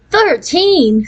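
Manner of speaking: high, startled disbelief; shocked. A narrator's reading. Sally hears the boyfriend is thirteen, shocked. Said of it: "Sally Mason was shocked".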